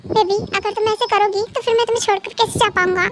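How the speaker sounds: usual speaking voice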